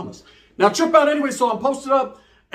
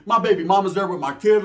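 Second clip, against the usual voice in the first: ordinary talk, nothing marked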